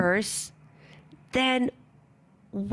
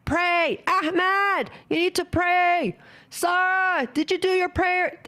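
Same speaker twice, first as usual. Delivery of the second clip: yelling across the house; 7 semitones higher than usual. Acted. Mother yelling across the house at her kids to pray, mock nagging shout. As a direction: bark the kids' names to make them pray, hammed-up. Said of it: "don't yell across the house to pray".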